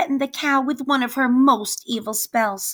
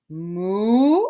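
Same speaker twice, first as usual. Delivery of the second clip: cow moo. Acted. Cow answers the witch.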